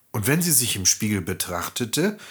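ordinary delivery

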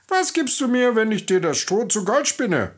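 high, piping little-man voice; fluting little man. Acted. The little man greets the crying miller's daughter in a high fluting voice. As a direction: flute the greeting like a tiny sly goblin.

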